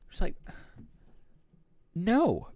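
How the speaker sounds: loud, high-pitched "no"; emphatic refusal. Real, not acted. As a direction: burst out in emphatic disbelief. Rejects friend's late wake-up preference.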